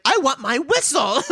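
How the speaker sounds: put-on high, loud toddler wail; mock crying child. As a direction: demand it like a bawling toddler. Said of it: "Evering is crying".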